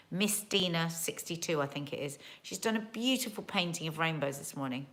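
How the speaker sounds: normal talking voice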